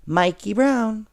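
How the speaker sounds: put-on snowy boy greeting his mother; little boy voice. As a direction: pop up and greet your mom.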